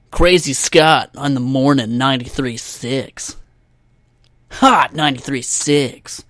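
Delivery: slow, punched DJ station ident; radio station announcer. Acted. Podcaster parodying morning radio, announcer voice. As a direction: announce it like a morning-show jock. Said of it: "radio host".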